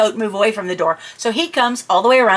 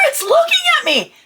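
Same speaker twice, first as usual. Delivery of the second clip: much higher frantic wail; incoherent panic. Retold or imitated. Telling husband the goat stares, terrified wail. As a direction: cry it out in terror. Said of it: "I apparently wasn't being coherent".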